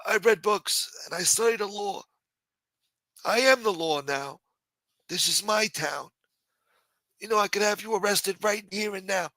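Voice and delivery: high, dismissive put-on councilman voice; A-Light voice. Councilman brushing off the harasser, high put-on brushoff. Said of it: "My A-Light voice changed".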